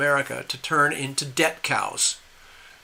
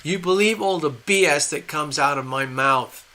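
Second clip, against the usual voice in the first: mocking derision. Leader laughing at gullible Americans, mocking gloat. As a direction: jeer at the fools you have tricked. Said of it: "they're laughing at us and going".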